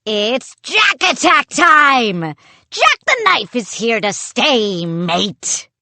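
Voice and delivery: loud, drawn-out pirate crowing; gloating pirate puppet. Acted. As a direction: bellow it like a triumphant toy pirate. Pirate puppet crows at children.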